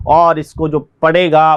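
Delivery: ordinary delivery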